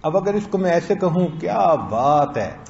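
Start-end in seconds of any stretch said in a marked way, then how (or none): 1.3-2.6 slow, drawn-out praise falling at the end; amazed admiration. Shown as a demonstration. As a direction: stretch क्या बात है in wonder. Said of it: "मैं ताज्जुब में, तारीफ में कह रहा हूं".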